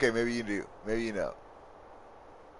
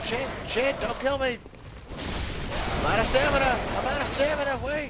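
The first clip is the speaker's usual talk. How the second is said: panicked. Real, not acted. Out of stamina while enemies attack, panicked high cries. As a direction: yelp it in real panic.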